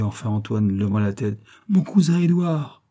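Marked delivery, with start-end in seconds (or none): 1.7-2.8 high, drawn-out exclamation; slow, high call with the big news; excited announcement. A narrator's reading. Girl telling her father who has come, excited.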